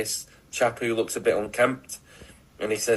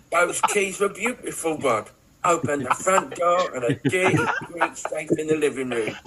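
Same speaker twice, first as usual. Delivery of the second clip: put-on crook impression; 4 semitones higher than usual. Crook telling Rod about the safe, hammed-up impression. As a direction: play a comic dodgy-pub crook. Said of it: "doing that impression".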